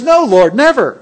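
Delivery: loud, high protest; Peter's loud emphatic no; emphatic refusal. Acted. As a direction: cry out no, refusing it. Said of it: "Peter doesn't like that plan".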